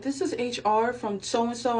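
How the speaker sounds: slow, formal office voice; HR voicemail voice. Retold or imitated. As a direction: read it like a recorded message. Imitating HR voicemail.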